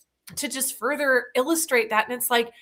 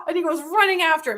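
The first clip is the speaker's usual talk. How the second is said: ordinary talk, nothing marked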